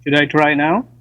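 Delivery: clear phone line. Real, not acted. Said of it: "Ooh, that's very clear".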